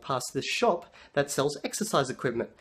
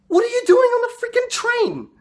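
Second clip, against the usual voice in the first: very high, loud mock-furious screech; incredulous yelling; 8 dB louder than usual. Husband learning his wife took the train, acted furious yell. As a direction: explode at her, voice shooting up.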